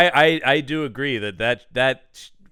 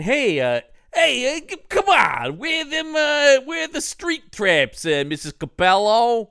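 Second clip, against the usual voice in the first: much higher, louder brash buddy voice, slow in places; Ralph's greeting; 9 semitones higher, 5 dB louder, slower than usual. Retold or imitated. Ralph bursts into the kitchen greeting Missus Capello, loud and high. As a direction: barge in like the cheery neighbour kid.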